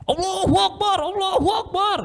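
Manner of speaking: high-pitched frantic Allahu Akbar; panicked cries. Retold or imitated. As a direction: scream it in the middle of turbulence. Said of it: "Kalau anak-anak muda". (anak muda berteriak panik saat pesawat jatuh)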